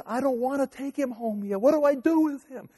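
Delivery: high, pleading mock cry; wife to the nurse, imitated as high and tearful; imitating tearful wife. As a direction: mimic her tearful plea, pitched high. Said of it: "and she starts crying".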